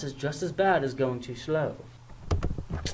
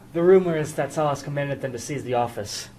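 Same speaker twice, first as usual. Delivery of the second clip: ordinary talk, nothing marked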